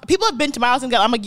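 ordinary delivery

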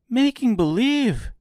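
high, loud disbelieving echo; cried out in surprise. A narrator's reading. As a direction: cry it out, astonished. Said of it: "cried Dorothy".

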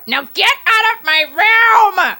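put-on furious teen yelling at his sister; angry shout. Angry teen yelling at his sister to get out. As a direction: yell at her to get out.

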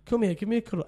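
usual speaking voice

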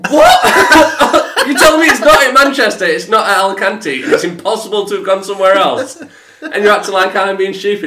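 loud, high, laughing outrage; laughing disbelief. Retold or imitated. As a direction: laugh through the indignation. Outraged retelling of airline call.

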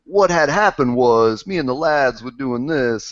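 mock English lad accent, slow; hammed-up cockney lad English accent. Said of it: "and that's my English accent".

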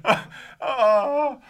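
high, loud words through laughter; laughing while talking. Real, not acted. Cohost says he's losing it, laughing through the words. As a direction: fight through the giggles. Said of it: "You're losing it".

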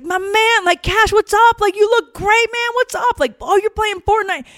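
very high, loud, hearty hype; hyped-up greeting. Retold or imitated. Trainer cheering up a hurt boy upstairs, hyped-up and high. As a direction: imitate a pumped-up coach greeting his buddy.

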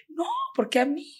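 high, plaintive cry of distress; retold terrified crying. Girl collapsed outside her friend's house, tearfully distraught. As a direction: re-enact a tearful plea without raising the volume. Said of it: "me pongo a llorar despavorida".

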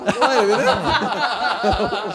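giggling through the words; laughing. Real, not acted. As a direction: laugh while talking. Laughing mid-quote.

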